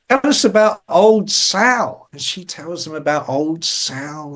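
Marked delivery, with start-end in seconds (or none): 0.1-2.1 higher, slow, drawn-out request; imitating Dave's eager prompting. Dave coaxes the old lady for her story, playfully eager. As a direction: coax the story out, stretching the words. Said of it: "He's like".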